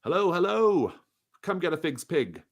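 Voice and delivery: loud, high, hearty merchant greeting; jolly pie seller. Acted. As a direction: boom it out like a cheery butcher hawking pies. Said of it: "he's like".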